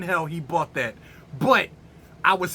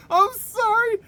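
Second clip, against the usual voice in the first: high, giggled apology; laughing apology. Real, not acted. Reviewer apologising to viewers, laughing. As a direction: apologise through helpless laughter.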